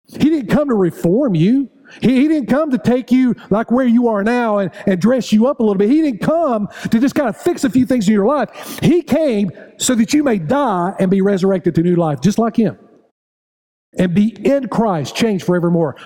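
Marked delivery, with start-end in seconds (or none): 0.1-11.1 preaching. Acted. Preaching at full force.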